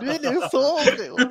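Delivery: high laughing repeat. Real, not acted. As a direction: laugh through the line.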